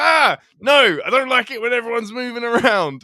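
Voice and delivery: high, loud fussy complaint; mock grumpy local. Retelling a stand-up bit about immigrants, a loud fussy mock complaint. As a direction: whinge like someone upset that people move. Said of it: "And he's like".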